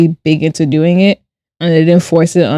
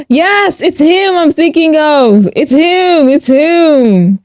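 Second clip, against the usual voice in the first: high gasp, then a high, slow "it's him"; delighted recognition; 9 semitones higher, much slower than usual. Real, not acted. Streamer recognising the actor in a Google search, high and delighted. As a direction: gasp and squeal as you spot him.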